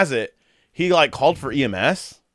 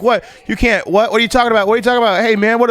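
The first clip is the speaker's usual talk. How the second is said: high, loud outraged disbelief; mock indignant what-are-you-talking-about; protesting player; 10 semitones higher, 5 dB louder, much faster than usual. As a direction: play the player yelling at the ref. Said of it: "She's like".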